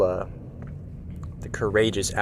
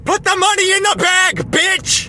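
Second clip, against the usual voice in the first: shrieking high bank robber; 7 dB louder than usual. Pretend. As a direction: yell the demand way up high. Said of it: "too much of a coward to rob a bank".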